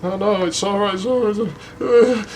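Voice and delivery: put-on high, hurried girl putting on a brave face; rising pitch, as if fighting tears; imitating holding back tears. Girl being turned down, imitated tearful reassurance. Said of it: "you see her eyes go watery".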